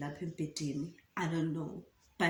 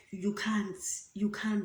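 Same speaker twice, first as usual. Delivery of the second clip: usual speaking voice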